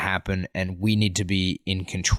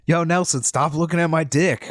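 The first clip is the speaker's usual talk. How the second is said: mock call-out, pitched up; higher-pitched mock public complaint; loud classroom call; 7 semitones higher than usual. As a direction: call out a classmate for everyone to hear. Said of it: "real loud".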